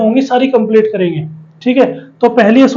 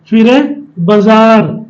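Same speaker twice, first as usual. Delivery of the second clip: ordinary talk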